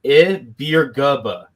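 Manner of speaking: "Ibirgubba" said carefully as ih-BEER-guh-buh; name pronunciation. Shown as a demonstration. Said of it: "the way you pronounce Mateen's last name".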